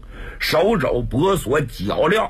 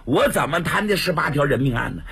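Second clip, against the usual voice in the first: ordinary delivery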